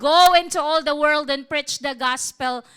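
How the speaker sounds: loud preacher's proclamation; forceful, full-volume quoted command; booming command. Acted. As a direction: deliver it as a commission from the Lord. Voices the Lord's command.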